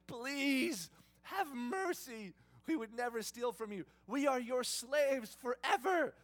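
loud, slow, high begging; hammed-up desperate begging; groveling plea. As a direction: throw yourself on his mercy, drawn out. Brothers groveling on the ground.

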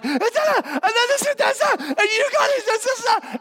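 high, breathless stammering; babbling excited. Acted. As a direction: tumble over the words. Women burst in, babbling.

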